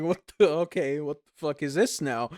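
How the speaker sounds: retold stunned outburst; baffled. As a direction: react like the world just flipped. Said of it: "I was like".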